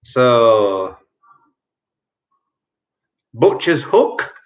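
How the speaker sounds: ordinary talk, nothing marked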